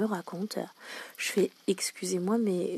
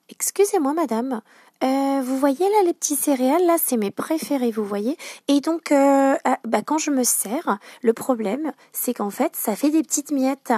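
customer asking; 5 semitones higher, 4 dB louder than usual. Retold or imitated. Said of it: "une dame qui vient vers moi et qui me demande".

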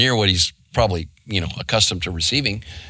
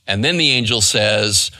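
usual speaking voice

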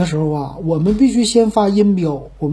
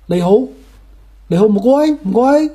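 higher-pitched amateur Cantonese greeting; Cantonese phrases; 3 semitones higher than usual. Shown as a demonstration. Showing off phrases from Guangzhou, amateur Cantonese. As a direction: try out sing-song Cantonese pleasantries. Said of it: "去了广州我也能整两句儿".

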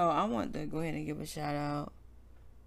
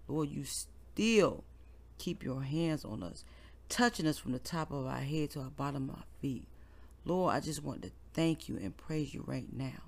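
heartfelt prayer; 5 semitones lower, 7 dB quieter than usual. Real, not acted. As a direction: pray aloud gently, like leading a congregation. Leads a prayer on air.

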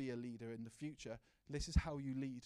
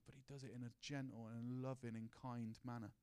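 ordinary talk

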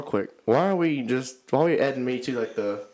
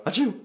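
high squeaky fake achoo; a terrible pretend sneeze, pitched high; 9 semitones higher, 3 dB louder than usual. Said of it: "That was terrible".

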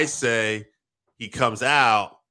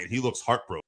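normal talking voice

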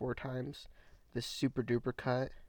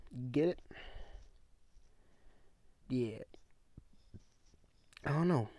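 normal talking voice

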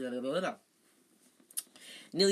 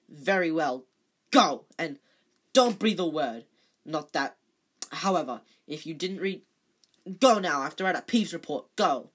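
loud, angry caretaker barking orders; a furious caretaker shouting a student out of his office; 9 dB louder than usual. Acted. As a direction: send the boy away at full roar. Filch furious, dismissing Harry.

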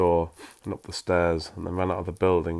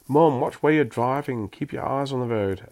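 louder, higher snapping at mum; barked order; 6 semitones higher, 4 dB louder than usual. A narrator's reading. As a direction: bark it at the driver. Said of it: "Erin barked".

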